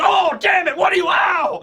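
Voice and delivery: villain getting scorched, yelling in pain; pained yelps. Retold or imitated. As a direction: yelp as if your face is burning. Aramaki's face getting burned.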